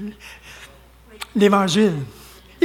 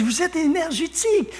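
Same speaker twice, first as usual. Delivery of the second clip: higher, softer mimicked listener; imitated French woman; 7 semitones higher, 4 dB quieter than usual. French woman remarking to the preacher, lightly imitated. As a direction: lightly mimic her in a raised voice. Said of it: "elle dit".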